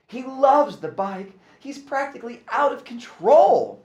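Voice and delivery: wife calling with good news; wife's voice. A narrator's reading. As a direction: gush about the boy. Wife's voice on phone.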